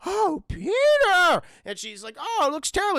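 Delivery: hammed-up gasping aunt; aghast aunt. Aunt seeing Peter's wound, high hammed-up horror.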